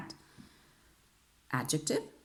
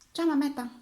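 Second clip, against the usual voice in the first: high, louder call out; high greeting to mum; 12 semitones higher, 5 dB louder, faster than usual. Real, not acted. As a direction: sing out a greeting to your mum. Breaks off lesson to greet mum.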